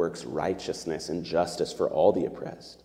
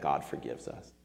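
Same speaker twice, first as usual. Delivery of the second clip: much softer and lower, set apart; hushed key truth; 4 semitones lower, 9 dB quieter than usual. Shown as a demonstration. Preacher giving the sermon's main point, hushed. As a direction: drop the voice to land the point.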